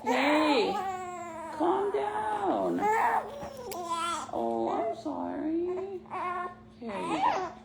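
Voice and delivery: high, slow soothing coo. Real, not acted. Mom soothing her crying baby in the car seat.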